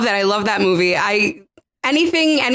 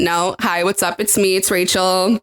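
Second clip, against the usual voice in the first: normal talking voice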